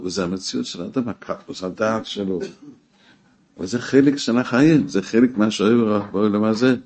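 normal talking voice

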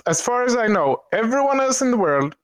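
ordinary delivery

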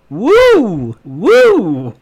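loud high ghostly wooing. Pretend. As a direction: wail like a cartoon ghost. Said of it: "like a ghost".

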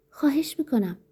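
high-pitched acted plea; frightened woman's appeal, lightly acted; pleading. A narrator's reading.

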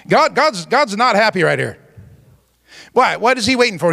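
ordinary delivery

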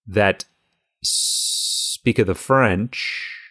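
very slow, stretched-out delivery; drawn-out mock saying. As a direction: drag out each word playfully. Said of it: "That's not a saying".

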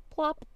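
high, loud, bouncy plop; playful plop. Acted. Sound of egg dropping.

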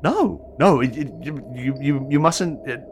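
high, loud, stammering panic; frantic disbelief. Acted. Seer frantically insisting to Hakim, stammering. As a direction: stumble over words in rising panic.

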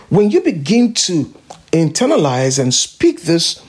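ordinary talk, nothing marked

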